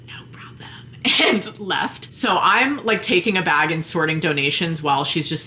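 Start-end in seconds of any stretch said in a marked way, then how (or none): 0.1-0.7 barely voiced, hushed reply; whispered no problem. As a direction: whisper it while sneaking out. Shopper slipping out.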